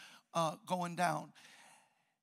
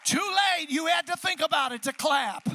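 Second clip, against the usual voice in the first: playful shout; 8 semitones higher, 10 dB louder than usual. Real, not acted. Teasing crowd for late applause.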